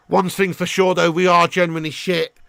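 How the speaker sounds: ordinary delivery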